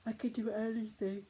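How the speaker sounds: acting out angry strength; empowered anger. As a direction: push it out through clenched muscles. Models feeling powerful from anger.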